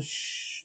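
unvoiced, quiet shushing; gentle "shh" under the breath; soft hush. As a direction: hush a child to sleep. Hushing a child to sleep.

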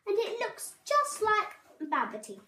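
high mouse voice, delighted; excited cry. A narrator's reading. Mouse teases about the cloud, excited cry. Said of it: "cried Mouse".

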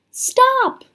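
high, loud cry of protest. A narrator's reading. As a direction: cry out to halt them, pitched high. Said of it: "cried Annie".

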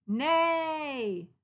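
high, loud pretend neigh; loud kitty neigh. Kitty neighs into her friends' ears, loud. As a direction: blast it right into their ears.

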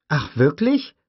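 put-on high, curious child's question; a little girl intrigued. Lex peers at the raptor, curious high child voice. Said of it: "fragte Lex und spähte neugierig".

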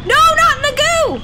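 very high, loud wail of protest; high-pitched gamer yell; dismayed shout. Real, not acted. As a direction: cry out in dismay at the game. Calling out at game mishap.

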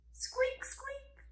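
tiny, very high, soft squeaks; squirrel squeak. Pretend. Teacher introducing the squirrel, tiny high squeaks. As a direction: squeak softly like a little squirrel.

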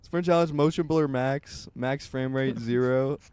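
loud, slow mock announcement; announcer voice. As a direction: proclaim it like a game-show host.

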